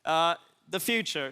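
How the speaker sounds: ordinary talk, nothing marked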